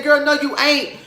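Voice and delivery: high, loud, incredulous read; loud high-pitched "girl, bye" scolding; sassy callout. Retold or imitated. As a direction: clap back with full attitude. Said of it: "I said".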